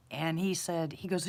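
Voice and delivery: usual speaking voice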